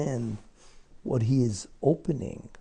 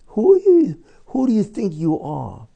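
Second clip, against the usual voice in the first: high, loud cry of outrage; indignant shout; 6 semitones higher, 6 dB louder than usual. Real, not acted. Preacher confronting the president over Israel, furious high shout. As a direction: hurl it at him like an accusation. Preacher rebukes Trump's audacity.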